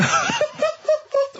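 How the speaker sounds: very high, breathless giggle; squealing laugh. Real, not acted. Squealing laugh at own clue.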